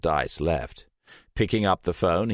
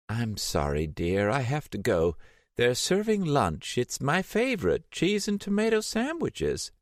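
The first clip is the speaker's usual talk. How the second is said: put-on high, wavering voice of a confused elderly woman; a frail old lady, lost and vague; old Selena on the phone to Steiner, confused and frail; 9 semitones higher than usual. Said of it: "old Selena drifts off to lunch".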